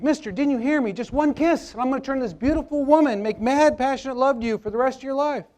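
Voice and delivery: high-pitched, urgent repeated plea; insistent talking frog. Acted. Frog repeating its ignored offer to Bob, high and insistent. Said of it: "frog looks him in the eye and says".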